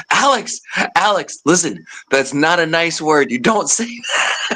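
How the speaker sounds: high-pitched mock-urgent plea; urgent hushed correction. Cook running up to stop his coworker, mock-urgent high voice.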